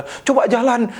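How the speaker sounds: high-pitched healing preacher's command; Benihin. Retold or imitated. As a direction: imitate a faith healer ordering someone to walk. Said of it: "Saya tiru-tiru Benihin gitu".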